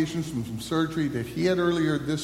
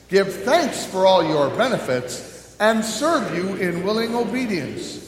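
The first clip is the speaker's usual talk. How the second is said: louder, measured prayer voice; public prayer; 6 semitones higher, 5 dB louder, slower than usual. Acted. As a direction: pray aloud for the whole congregation. Pastor leads closing prayer.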